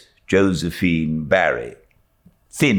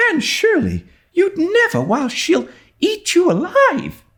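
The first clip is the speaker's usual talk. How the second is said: very high, gasping alarm; horrified at her friend's plan; alarmed girl. A narrator's reading. Diana to Anne, high with alarm.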